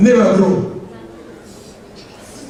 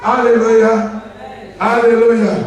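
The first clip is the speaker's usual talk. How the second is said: praise shout; 3 semitones higher than usual. Real, not acted. Preacher praising after testimony.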